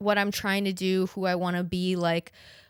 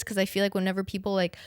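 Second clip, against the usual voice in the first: ordinary talk, nothing marked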